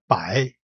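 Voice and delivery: "Bai" going down and going upward again; low dip then rise on "Bai"; third tone. Shown as a demonstration. Teacher repeats the same thing, "Bai" falling then rising. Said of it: "going down and going upward again".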